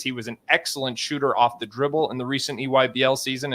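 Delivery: ordinary talk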